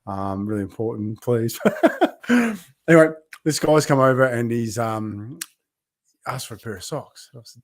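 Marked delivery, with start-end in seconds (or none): 1.6-2.6 short bright laugh; spontaneous happy laugh; high burst of laughter. Real, not acted. Laughs at live chat.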